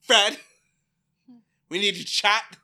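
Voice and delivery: loud and high; hammed-up stern summons; mock ominous call. Ominous Alice confrontation.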